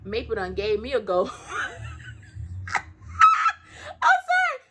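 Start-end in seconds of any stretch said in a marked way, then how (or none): 1.4-2.6 shrieking high laugh. Real, not acted. Admitting to viewers Maple beat her, shrieking laugh. 2.7-4.6 high, loud apology through laughter; laughing apology. Real, not acted. Apologizing to viewers while laughing hard. Laughing about Maple's difficulty.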